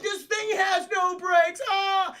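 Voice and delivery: high, loud, drawn-out yell; mock panicked scream. Mario careening toward the cliff edge, hammed-up screaming panic.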